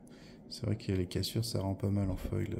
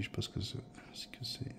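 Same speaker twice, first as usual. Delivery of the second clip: whispering; 10 dB quieter, faster than usual. Real, not acted. Muttering to himself over a card.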